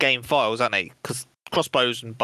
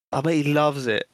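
ordinary talk, nothing marked

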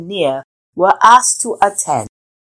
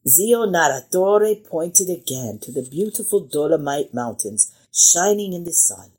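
usual speaking voice

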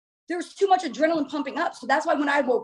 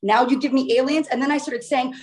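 usual speaking voice

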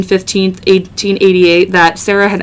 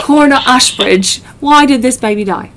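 lightly put-on British accent, a touch higher; bad British accent; 4 semitones higher than usual. Podcaster playing the coroner in a bad British accent. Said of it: "with that god-awful accent".

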